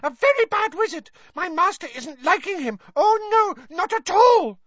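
high, loud indignant elf voice; Winky denounces Bagman, high and angry; angry house-elf. Acted. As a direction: spit out her contempt for Bagman. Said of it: "she looked angry again".

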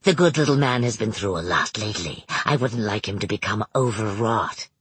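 a lightly voiced cheery barker, low; bright showman's patter, deep; sunny carnival barker. A narrator's reading. Narrator voices Manx.

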